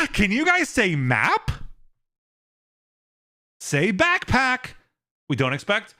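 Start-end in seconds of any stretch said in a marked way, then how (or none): 0.1-4.7 put-on high, slow kids' show host; Dora-style host. Streamer parodying Dora to chat, high slow kids' host. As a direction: call out to little viewers, high and slow. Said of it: "Dora the Exploring Your Lectures".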